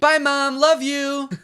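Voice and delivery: high, singsong farewell; hurried goodbye call. Pretend. Late teen calls goodbye to mom, high singsong.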